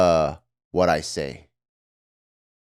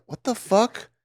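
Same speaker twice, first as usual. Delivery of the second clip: high, hushed mock disbelief; stunned disbelief; 8 semitones higher, 5 dB quieter than usual. Daughter reacts to mom's changes, high and hushed. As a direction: say it floored and quiet. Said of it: "I'm like".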